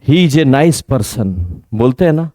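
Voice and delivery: normal talking voice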